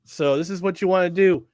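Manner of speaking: usual speaking voice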